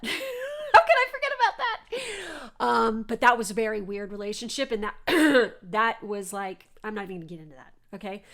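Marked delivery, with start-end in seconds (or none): 0.0-2.5 squealing laugh, much higher and louder; high, loud laughing disbelief. Real, not acted. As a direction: laugh through the question in disbelief. Laughs at forgotten ex.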